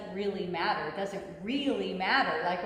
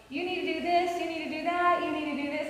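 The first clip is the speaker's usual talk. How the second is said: mock bossy husband, pitched higher; 6 semitones higher, faster than usual. Wife voicing husband's nagging as a higher bossy puppet. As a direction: nag like a puppet master pulling strings. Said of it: "my husband going".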